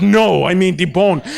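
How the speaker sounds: slow deadpan mom voice; a flat, drawn-out correction; mom's deadpan. Retold or imitated. Mom insisting to dad, slow deadpan reply. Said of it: "She goes".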